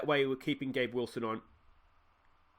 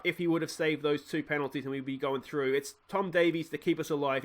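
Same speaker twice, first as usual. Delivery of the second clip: usual speaking voice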